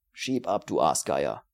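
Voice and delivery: low, gruff dismissal of a vulture of a dealer; growled. A narrator's reading. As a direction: growl him away with contempt. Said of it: "knurrte Steiner".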